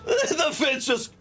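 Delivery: words squeezed out through laughter; can barely get it out for laughing; laughing helplessly. Real, not acted. Streamer cracking up at what happened in the game, laughing through the words.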